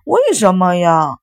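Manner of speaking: slow, loud drawn-out whine; stretched-out childish protest; whining child. A narrator's reading. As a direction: whine it like a thwarted little boy. Boy whines at being refused.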